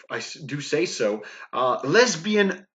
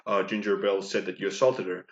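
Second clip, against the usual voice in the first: much lower, much softer officer voice; flat bored policeman reading the charge; deadpan cop; 8 semitones lower, 6 dB quieter than usual. Acted. Officer telling the arrested guy the charge, low deadpan.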